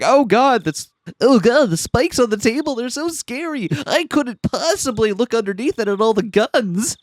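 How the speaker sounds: high, whiny mock fright; mock scared. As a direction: ham up being terrified. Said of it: "And he's like".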